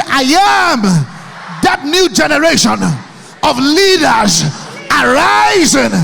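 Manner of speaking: high, slow, full-throated rallying cry; rally the hall to its feet; rising shouted vow. Shown as a demonstration. Leads a shouted affirmation.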